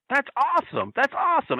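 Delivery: high-pitched retold excitement; mimicking a thrilled wrestler; imitated delight. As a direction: marvel at it, pitched well up. Imitating Flair's delight at the sign.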